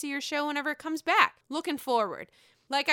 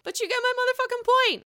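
high, loud, emphatic burst; emphatic insistence; 13 semitones higher, 6 dB louder, faster than usual. Real, not acted. Host waving away the bad French accent, loud high insistence.

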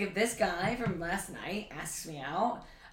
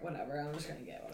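careless; 5 semitones lower, 13 dB quieter, faster than usual. Retold or imitated. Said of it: "kinda being like".

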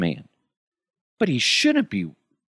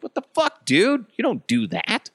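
high, shocked squeal at a friend; sudden indignant outburst; shocked indignation at a friend stealing his fries; outraged disbelief; 14 semitones higher than usual. Retold or imitated. Outraged at fries being stolen.

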